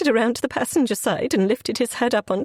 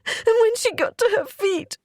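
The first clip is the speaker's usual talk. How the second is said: tearful narration; 12 semitones higher than usual. Real, not acted. Narrator breaks down recounting accident.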